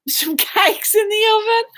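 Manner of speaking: laughing through the words; laughing apology for rushing off to bake. Real, not acted.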